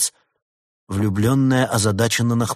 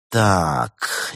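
usual speaking voice

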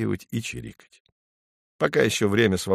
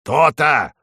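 louder, higher crow cry; crow's loud gotcha; straining crow; 5 semitones higher, 5 dB louder than usual. A narrator's reading. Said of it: "надрывается ворона".

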